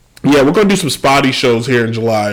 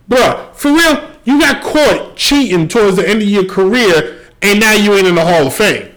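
incredulous call-out; 8 semitones higher than usual. Real, not acted. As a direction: call him out in disbelief. Calls out Manny Ramirez.

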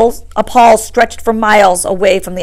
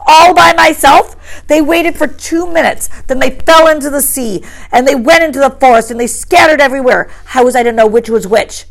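indignant; 5 semitones higher, 4 dB louder than usual. A narrator's reading. Piggy protesting to Ralph, indignant. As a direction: throw the question back, offended. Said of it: "cried Piggy indignantly".

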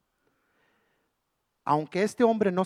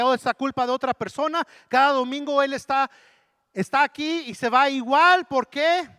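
loud, building preaching with a repeated phrase; preaching at full force; 7 semitones higher, 8 dB louder than usual. Acted. Pastor rebuking churchgoers who make excuses, loud. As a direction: hammer the point home.

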